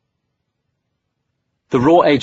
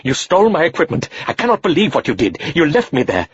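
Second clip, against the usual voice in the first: high, raised, furious reproach; angry scolding; 7 semitones higher than usual. Acted. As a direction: scold the reckless boy hotly. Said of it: "Rahim was in no mood".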